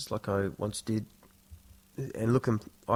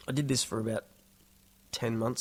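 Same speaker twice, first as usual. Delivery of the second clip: usual speaking voice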